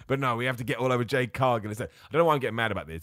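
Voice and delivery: usual speaking voice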